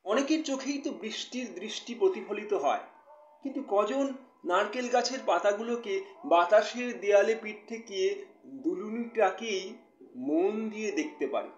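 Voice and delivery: expressive poetry reading with measured pauses; poem recitation. Acted. Poet reading a new poem to live viewers, expressive recitation. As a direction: recite it line by line, letting key words swell.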